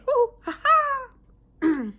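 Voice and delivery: loud, very high whoop into a "ha ha"; involuntary high-pitched outburst; vocal tic whoop. Real, not acted. Vocal tic in Tourette's talk.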